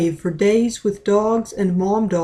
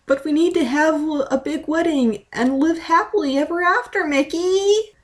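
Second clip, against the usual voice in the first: crying; 9 semitones higher than usual. Acted. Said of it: "She was cried hard".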